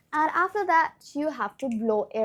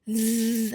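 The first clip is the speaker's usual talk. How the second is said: buzzing bee sound; 3 semitones lower than usual. Shown as a demonstration. Teacher demonstrates voiced th with a buzz. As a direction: hum a steady bee buzz. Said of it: "sound like a bee".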